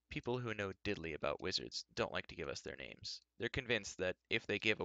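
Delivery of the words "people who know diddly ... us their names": usual speaking voice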